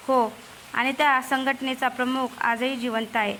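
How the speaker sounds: usual speaking voice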